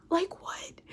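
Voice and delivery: same question, almost voiceless; whispered question. Retold or imitated. Woman questions sister about brother's claim, whispered. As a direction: let the voice fall away to a whisper.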